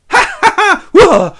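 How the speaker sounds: very high, loud crazed cackle ending in a whoop; manic laugh and whoop. Acted. Overexcited Scrooge learns it's Christmas, manic cackle. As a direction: cackle wildly, then whoop.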